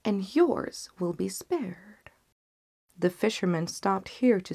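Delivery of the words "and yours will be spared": slow, whispered plea; begging for life. Acted. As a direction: plead on your knees, barely audible. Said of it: "he cried".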